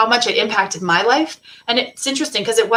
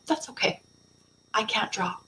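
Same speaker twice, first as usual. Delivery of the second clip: gentle quiet reply; 11 dB quieter than usual. Retold or imitated. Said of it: "and she said".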